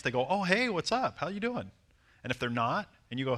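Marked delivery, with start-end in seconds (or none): 0.3-1.7 high, chirpy greeting; friendly and nice. Retold or imitated. Women answering a stranger's greeting, friendly. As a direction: imitate a woman happily returning a hello. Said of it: "if they're friendly and nice".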